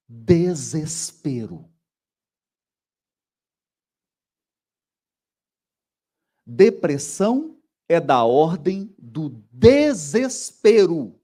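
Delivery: "Desespero" drawn out slowly, then stressed phrase; slow, weighty "desespero"; slow emphatic stress. Shown as a demonstration. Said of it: "eu vou dizer uma palavra". ("desespero" bem lento e enfático)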